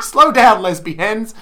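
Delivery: loud, laughing call; playful shout. Retold or imitated. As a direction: shout it cheekily from the street. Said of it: "Yeah, I said".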